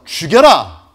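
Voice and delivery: loud, high cry of kill me; defiant yell. Pretend. Pastor daring the captors, loud defiant yell. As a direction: dare them at full volume. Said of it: "제가".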